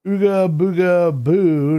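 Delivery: high, loud and slow spooky boo; mock spooky monster. Streamer pretending to spook his viewers, slow hammed-up boo. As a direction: ham up a ghost trying to scare chat. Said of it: "did I scare you?".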